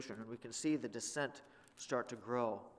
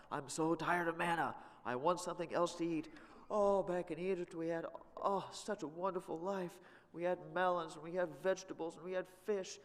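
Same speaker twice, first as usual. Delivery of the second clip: high, whiny, nostalgic for Egypt; whining Israelites; 6 semitones higher than usual. Pretend. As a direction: whine like spoiled ex-slaves. Preacher voices the grumbling people.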